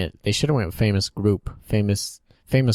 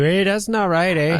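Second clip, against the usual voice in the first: high-pitched cartoon Canadian protest; mock Canadian accent; 10 semitones higher, 4 dB louder than usual. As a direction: complain as a put-on Canadian. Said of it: "Are they Canadian too?".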